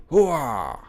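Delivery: gung-ho hooah war cry; battle cry. Acted. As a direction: bellow a rallying war cry.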